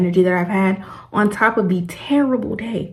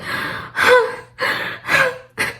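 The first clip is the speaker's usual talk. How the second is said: exhausted gasp. Retold or imitated. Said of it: "I'm literally like".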